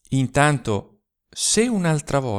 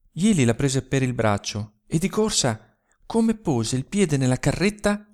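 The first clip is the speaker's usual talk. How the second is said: usual speaking voice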